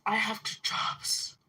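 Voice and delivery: unvoiced mocking whisper of the sister; mock whisper of her line. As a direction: sneer it back under your breath. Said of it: "She's like".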